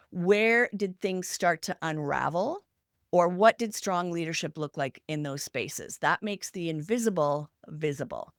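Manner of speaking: normal talking voice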